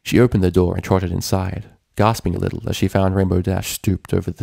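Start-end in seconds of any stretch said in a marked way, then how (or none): none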